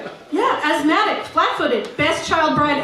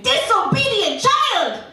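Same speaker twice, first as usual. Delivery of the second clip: higher, slower mock scream; mother screaming; 8 semitones higher, slower than usual. Mother scolding her bad child, hammed-up shriek. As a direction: bellow it like a furious knife-waving mum.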